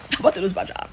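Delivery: soft, quick laughing repeat. Real, not acted. Laughing at her own retold panic, softly and quickly. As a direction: laugh it off under the breath.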